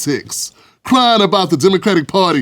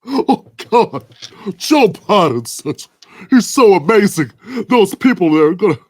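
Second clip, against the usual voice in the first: high, weepy fake sobbing through the words; hammed-up blubbering pundit; mock crying; 3 semitones higher than usual. Host mocking a tearful TV pundit, mock weepy sobbing. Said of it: "who's the other crybaby".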